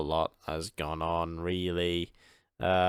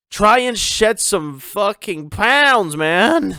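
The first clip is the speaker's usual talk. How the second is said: put-on slow, loud, much higher American accent; 12 semitones higher, 11 dB louder, much slower than usual. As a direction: throw on a big booming American accent. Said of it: "I don't know why I said that in an American accent".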